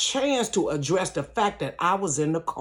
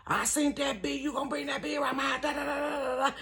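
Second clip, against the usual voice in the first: imitated ranting woman; 9 semitones higher, 8 dB quieter than usual.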